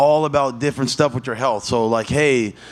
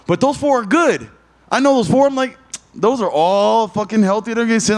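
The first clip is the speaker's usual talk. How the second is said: excited; 9 semitones higher, 3 dB louder than usual. Retold or imitated. Excited about knowing vitamins.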